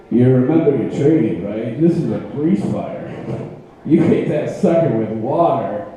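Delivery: low, grim tough-guy warning; Clint Eastwood impression. Chubby conscience angel on his shoulder warns him, low grim tough-guy voice. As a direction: growl it like a weathered cowboy hero. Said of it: "fat Clint Eastwood impression".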